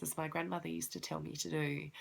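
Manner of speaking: ordinary talk, nothing marked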